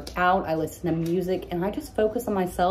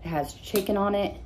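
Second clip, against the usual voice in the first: ordinary delivery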